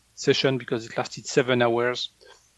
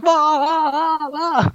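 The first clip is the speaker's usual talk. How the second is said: high, loud wailing imitation of a man crying; mock crying; 14 semitones higher, 6 dB louder, slower than usual. Healer mimicking the executive's crying, high wailing. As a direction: wail wah wah like a crybaby, much higher. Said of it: "And he was like".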